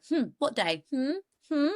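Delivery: mock-suspicious questioning hums; pry with a pointed, curious hum; lightly acted quizzical doctor; probing psychologist. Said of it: "Doctor Monica's like".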